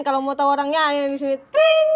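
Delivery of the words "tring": high, loud "tring"; mouth-made pop-up chime. Shown as a demonstration. Introducing the HRD colleague's photo, high mock chime. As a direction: sing out an editing sound effect.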